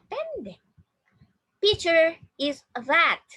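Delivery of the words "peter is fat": slow, loud, raised-pitch model sentence; teacher reading slowly and loudly. Shown as a demonstration. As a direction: draw each word out for learners to copy. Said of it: "Please repeat after me".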